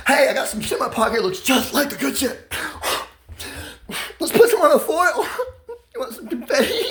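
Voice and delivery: giggling, mock-tempting offer; laughing party offer. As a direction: tease them with the trick while laughing. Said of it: "Fucking great party favor".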